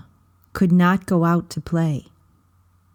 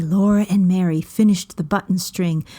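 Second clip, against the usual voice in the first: ordinary delivery, nothing marked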